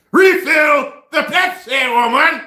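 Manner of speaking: loud slow bossy shout. Retold or imitated. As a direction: bark the order. Himself yelling at girlfriend.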